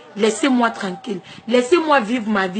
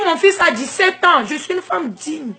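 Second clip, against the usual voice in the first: usual speaking voice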